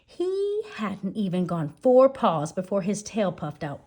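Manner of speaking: plain reading voice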